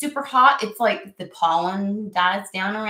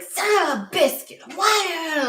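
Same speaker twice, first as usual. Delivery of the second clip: high, louder mock-angry cry; mock mad outburst; 10 semitones higher, 4 dB louder, slower than usual. Venting at sneezes in mock anger, high and loud. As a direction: fake fury at her own sneezing. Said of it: "I get so mad".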